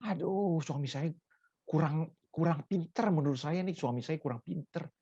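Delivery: quiet, mock wifely complaint; mild grumbling. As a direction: grumble softly, half to yourself. Acts wife grumbling about husband. (keluhan istri yang pelan, dibuat-buat)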